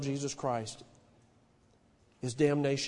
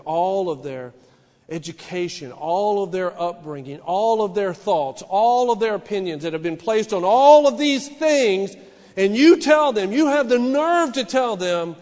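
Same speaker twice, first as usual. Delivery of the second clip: starts firm, climbs louder and higher to a peak; preaching building to a roar; 6 semitones higher, 13 dB louder than usual. Acted. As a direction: build the list up until you're thundering at them.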